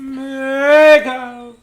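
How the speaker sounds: high, loud swell on a held note; drawn-out zooming wail; whizzing past. Shown as a demonstration. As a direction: swell and fade like passing at speed. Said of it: "Maybe she's going by really fast".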